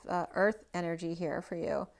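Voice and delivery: usual speaking voice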